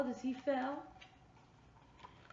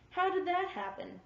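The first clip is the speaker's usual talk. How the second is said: higher, mildly cross question; frowning question; 7 semitones higher, 4 dB louder than usual. A narrator's reading. Danny questions the dogs over his tangled leash, mildly frowning. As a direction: ask it high, with a touch of a frown.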